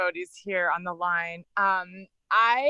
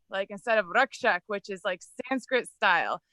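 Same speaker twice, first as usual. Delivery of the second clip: normal talking voice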